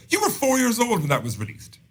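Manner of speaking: high-pitched mock outrage; incredulous retort. Retelling an incredulous retort to friends.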